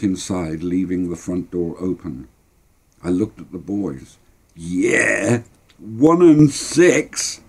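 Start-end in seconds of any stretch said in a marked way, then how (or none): 4.6-7.4 put-on drawn-out, rising-pitched boyish glee; gloating delight. Boy to his mates about Ma's money, gloating. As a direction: stretch it out slowly, high and triumphant.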